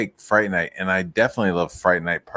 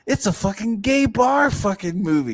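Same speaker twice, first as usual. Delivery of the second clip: ordinary delivery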